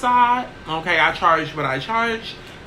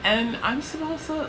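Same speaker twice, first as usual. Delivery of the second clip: pitched up high, soft and dainty; 7 semitones higher, 6 dB quieter than usual. Shown as a demonstration. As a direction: lift the voice up high and make it dainty. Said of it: "I don't even think that's high enough".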